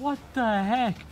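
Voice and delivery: slow, high, stretched-out outrage; drawn-out baffled cry; disbelief. Real, not acted. Car totaled.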